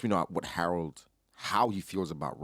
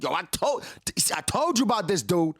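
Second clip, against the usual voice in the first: higher, louder exasperated protest; mock exasperated yell, pitched up; frustrated dude; 12 semitones higher, 4 dB louder, faster than usual. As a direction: throw up your hands at a kid who won't listen. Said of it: "And the dude's like".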